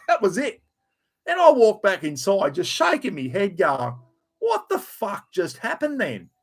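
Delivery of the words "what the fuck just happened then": retold disbelief. His own disbelief, retold.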